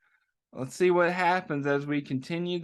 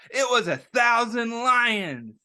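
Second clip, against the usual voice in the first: higher, playful fib; teasing Joe; 6 semitones higher, 4 dB louder than usual. A narrator's reading. Reading to children, Joe teases the kids playfully. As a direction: play along with a grin.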